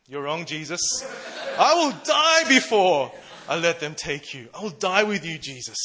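loud, high vow of loyalty with a laugh; bold declaration. Retold or imitated. Peter defies Jesus, a loud laughing vow of loyalty. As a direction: swear you'd die for him, all in. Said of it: "this bold declaration of his unwavering loyalty".

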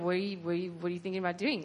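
low, quiet aside; softly voiced inner question; hushed wondering. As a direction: murmur it under your breath, very low. Imagined aside to Jesus, fearful.

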